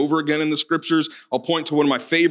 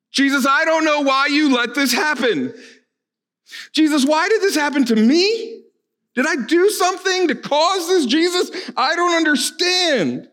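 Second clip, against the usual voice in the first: much higher pleading, despairing cry to Jesus; anguished prayer; 13 semitones higher than usual. Pretend. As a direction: voice a grieving believer's anguish. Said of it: "pour out our questions".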